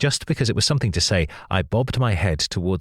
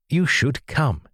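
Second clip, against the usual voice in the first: brightly eager invitation, pitched up; keen to have her join, lifted and eager; husband keenly inviting the new woman to his book club; enthusiastic; 7 semitones higher, slower than usual. Acted. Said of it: "he said enthusiastically".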